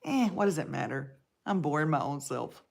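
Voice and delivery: a dull, deflated aside; bored self-talk. Retold or imitated. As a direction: mutter it like you're boring yourself. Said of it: "I just wanna stop and say".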